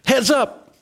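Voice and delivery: half-voiced ballpark yell; imitated yell. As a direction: call out a mock warning to the stands. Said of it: "and I yell".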